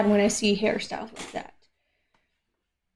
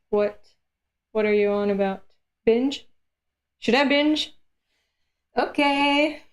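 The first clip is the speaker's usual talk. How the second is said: ordinary delivery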